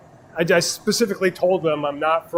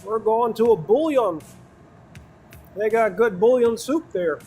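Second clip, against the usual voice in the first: put-on Wisconsin accent; 5 semitones higher than usual. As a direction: play the cheese-and-kraut Wisconsin local. Hamming up Wisconsin stereotypes.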